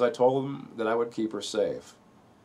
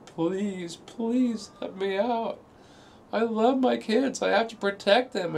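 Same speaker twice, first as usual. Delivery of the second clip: high, slow begging wail, much higher than his own voice; imitated crying plea; 12 semitones higher, slower than usual. Husband retells wife begging at the locked door, high crying plea. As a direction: plead through tears to be let out. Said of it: "sit in front of the door ... crying".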